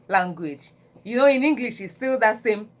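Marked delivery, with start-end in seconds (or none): none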